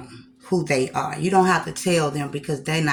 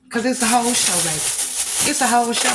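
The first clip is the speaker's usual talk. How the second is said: hyped up; 5 semitones higher than usual. Real, not acted. Hyping her Looney Tunes shirt.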